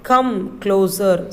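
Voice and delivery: "Come closer" drawn out slowly; slow model reading. Shown as a demonstration. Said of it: "let's read the next line".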